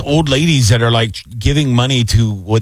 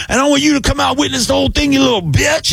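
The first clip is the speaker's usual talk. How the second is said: high mocking taunt. Taunting the scammer.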